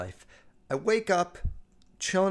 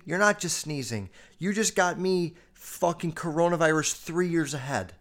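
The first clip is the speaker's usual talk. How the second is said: usual speaking voice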